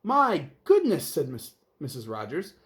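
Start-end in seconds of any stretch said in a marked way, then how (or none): 0.0-1.1 high, loud, slow my goodness; shocked gasp. Acted. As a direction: clutch your pearls. Mrs Rogers shocked.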